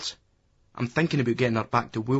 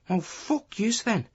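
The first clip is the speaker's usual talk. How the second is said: high, slow indignant brush-off; indignant retort; 10 semitones higher, slower than usual. A narrator's reading. Lassie ignored by the boys, indignant retort. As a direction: draw out a high, put-out retort. Rebuffed lassie swears at narrator.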